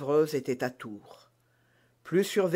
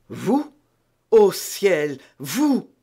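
lightly voiced queen, startled and louder; surprised cry; 3 semitones higher, 7 dB louder, much slower than usual. A narrator's reading. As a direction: exclaim in disbelief at the maid. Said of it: "s'écria la reine". (la reine s'écrie, étonnée)

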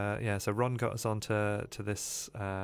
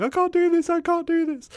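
high, loud panicky cry; imitating a terrified kid clinging on; scared pleading; 10 dB louder than usual.

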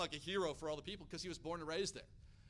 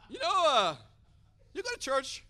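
awkward loud, higher-pitched invitation with hesitation; trying to sound cool; 7 semitones higher, 13 dB louder than usual. Retold or imitated. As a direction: blurt the question clumsily, mocking yourself. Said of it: "trying to think of a cool way to say it".

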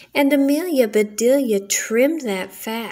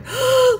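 sharp high gasp of shock; 14 semitones higher, 3 dB louder than usual. Acted. Housewife sees her ruined furniture, sharp high gasp. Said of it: "she cried".

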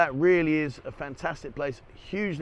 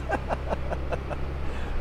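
breathy unvoiced laughter; a long helpless laugh; real laugh; 3 semitones higher than usual. Real, not acted. Laughing at the joke to the camera.

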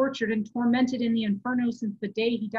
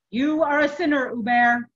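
loud, raised accusation; angry rebuke; 4 semitones higher, 10 dB louder than usual. A narrator's reading. Furious monk condemns the abbot, angry rebuke. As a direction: denounce him forcefully.

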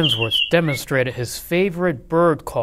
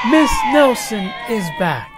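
high, loud eager shout; excited kids; 9 semitones higher, 7 dB louder than usual. A narrator's reading. Class excited.

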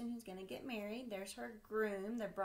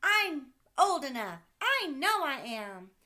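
put-on much louder, higher child voice, drawn out at the end; insistent little boy; 6 semitones higher, 14 dB louder than usual. Sam shouting to dad he is old enough, insistent child. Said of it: "asked Sam".

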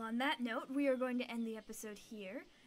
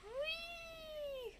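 gleeful whee; 14 semitones higher, 4 dB quieter than usual. Acted. Gibbon swinging again.

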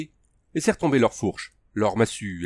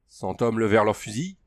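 ordinary delivery, nothing marked

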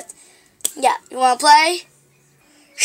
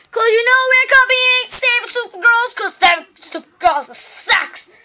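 high, raised-voice mock answer; mocking explanation; 7 semitones higher than usual. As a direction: pitch it up and spell out the obvious. Mocks the copied show's name.

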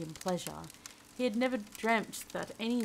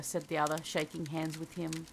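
usual speaking voice

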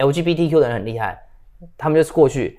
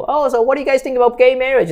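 a much higher, louder mock of a probing boss; imitating the supervisor; 12 semitones higher, 4 dB louder than usual. Retelling the supervisor's question at work, high mock voice. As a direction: put on the boss's voice, pitched well up. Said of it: "那个主管就过来问我说".

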